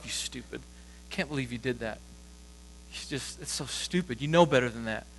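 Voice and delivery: hushed, muttered self-scolding; quiet inner voice of shame; self-critical inner voice. Acted. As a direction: berate yourself under your breath. Inner voice cutting yourself down.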